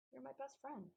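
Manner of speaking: soft, sincere and tender. Acted. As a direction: say it gently and sincerely. Tender reconciliation.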